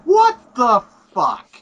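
drawn-out facepalm outburst; exasperated disbelief. Retold or imitated. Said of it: "face palmed myself and went".

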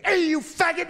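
high, loud heckling shout; hammed-up heckler yell. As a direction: yell it down from the balcony. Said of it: "some idiot yelled from the balcony".

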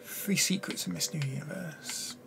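hushed mysterious whisper; fortune teller whisper. Acted. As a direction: whisper it like a seer. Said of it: "Fortune teller".

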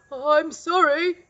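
spluttering dragon. A narrator's reading. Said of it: "he spluttered".

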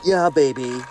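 ordinary delivery

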